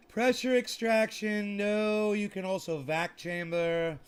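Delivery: loud, high burst on pressure extraction; emphatic outburst. Real, not acted. Ranting to viewers about extraction methods, loud outburst. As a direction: blurt it out with force.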